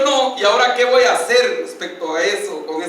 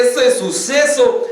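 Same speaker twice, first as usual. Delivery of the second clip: ordinary talk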